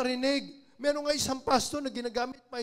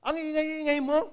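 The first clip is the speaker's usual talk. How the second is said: louder, annoyed telling him to shut up; angry scolding; 3 semitones higher, 6 dB louder than usual. Retold or imitated. Woman on the train scolding him again, loud and annoyed. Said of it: "Galit na naman sa kanyang babae".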